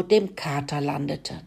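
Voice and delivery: ordinary delivery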